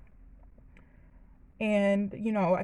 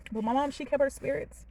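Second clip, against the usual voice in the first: higher, tearful and halting; choked up, struggling to get it out; crying; 4 semitones higher than usual. Real, not acted. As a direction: push on through real tears. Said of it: "I was not gonna cry".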